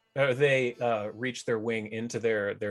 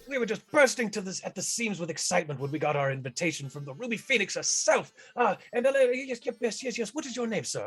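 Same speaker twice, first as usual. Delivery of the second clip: higher, excited greeter gushing yes yes; 8 semitones higher, faster than usual. Acted. [name] greets the party, high and gushing. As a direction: fawn over the tall guest like an overjoyed host.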